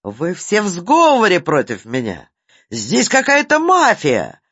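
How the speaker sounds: hysterical. A narrator's reading. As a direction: rant in a hysterical fit. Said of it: "дёргался Ситечкин".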